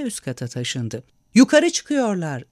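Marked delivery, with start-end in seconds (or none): 1.3-2.4 loud, higher, slow alarmed shout. A narrator's reading. Bartolomeo shouting that the mob is coming upstairs. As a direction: yell the warning to the whole room. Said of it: "diye bağırdı Bartolomeo".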